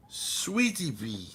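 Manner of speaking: slow, soft pet talk; cooing at cat. Real, not acted. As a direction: coo slowly to a beloved cat.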